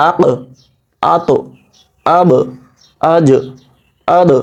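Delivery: each of "আক, আত, আব, আজ, আদ" stopped, then released with a small echo; bounced final consonants. Shown as a demonstration. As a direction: drop and bounce the last consonant of "আক", "আত", "আব", "আজ", "আদ". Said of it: "আমি drop দিয়ে পড়ছি".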